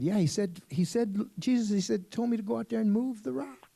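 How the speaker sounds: high and loud, climbing with each he said; a man eagerly defending himself; insistent George. Acted. George insists to Jesus he was told, rising and loud.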